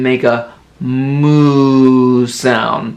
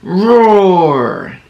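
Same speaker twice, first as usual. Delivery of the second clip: mock tiger roar; 3 semitones higher than usual. Shown as a demonstration. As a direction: roar for the kids. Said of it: "Tigers say".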